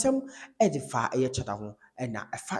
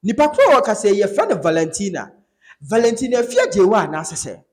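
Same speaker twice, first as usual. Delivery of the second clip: excited retelling; 7 semitones higher, 10 dB louder than usual. Retold or imitated. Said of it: "Ɛna Bolare sɛ".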